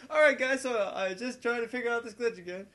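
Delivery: loud, high, laughing apology to viewers; half laughing, fed up; laughing, exasperated YouTuber; laughing exasperation. Retold or imitated. Retelling exasperated video line.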